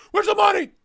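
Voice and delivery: mock thug demanding payment, high and loud; debt collector's shout. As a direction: yell it like a cartoon enforcer. Acting out a debt collector's threat.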